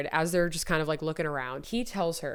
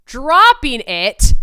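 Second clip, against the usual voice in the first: slow, loud, higher drop; dramatic emphasis; 7 semitones higher, 14 dB louder, much slower than usual. A narrator's reading. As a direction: land it dramatically.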